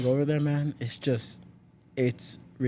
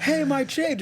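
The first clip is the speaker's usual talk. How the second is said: high-pitched retold call after someone; indignant high-pitched mock holler; 14 semitones higher, 3 dB louder, slower than usual. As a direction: call after a departing driver, voice leaping up. Calling after departing driver.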